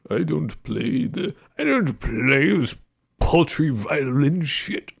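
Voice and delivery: snooty indignation. Pretend. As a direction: sniff at the violin like a snob. Indignant viola player bit.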